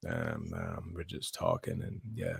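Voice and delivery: very deep, very soft droning voice; mock monotone. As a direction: drone low and quiet like a sleepy robot. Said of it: "You sound so monotone".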